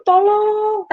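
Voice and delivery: high, drawn-out plea for help; cry for help. Retold or imitated. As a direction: imitate a woman calling for help. Perempuan di lumpur minta tolong. (minta tolong bernada tinggi)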